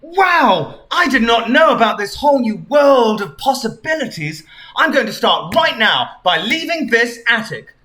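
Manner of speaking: high, wide-eyed wonder; excited Anne Frank. Acted. Anne Frank resolves to leave the attic, high excited wonder.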